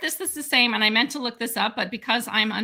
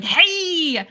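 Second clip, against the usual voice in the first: goat man calling out; 6 semitones higher, 5 dB louder than usual. Retold or imitated. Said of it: "pops out like".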